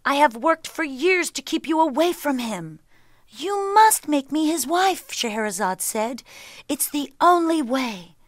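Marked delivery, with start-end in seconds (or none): 0.0-2.8 raised, high-pitched father's protest; protesting cry. A narrator's reading. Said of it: "cried the vizier". 3.3-5.1 high, loud, urgent insistence; insistent plea. A narrator's reading. Daughter insists against her father's refusal. 6.7-8.2 raised, high-pitched insistence; insistent plea. A narrator's reading. Daughter insists against her father's refusal.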